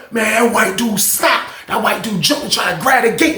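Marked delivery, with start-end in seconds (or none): none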